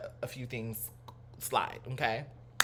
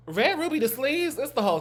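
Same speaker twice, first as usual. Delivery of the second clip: excited; 11 semitones higher, 6 dB louder than usual. Real, not acted. Excited exclaiming song title.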